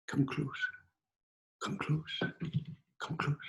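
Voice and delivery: soft, near-whispered invitation with pauses; hushed beckoning. A narrator's reading. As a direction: draw the listener in quietly. Little man beckons to share secret.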